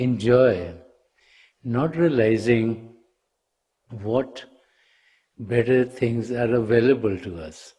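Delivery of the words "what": usual speaking voice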